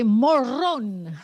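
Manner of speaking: "Morón" in a Spanish accent, stress on the last syllable; "Morón" with Spanish vowels and final stress. Shown as a demonstration. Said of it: "I'm saying it with my Spanish accent".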